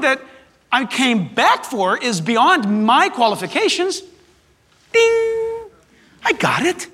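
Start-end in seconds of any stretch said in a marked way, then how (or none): 4.9-5.7 high bright ding of realization; idea ding. Retold or imitated. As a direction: ring out like a bell going off. Idea suddenly dawns on him.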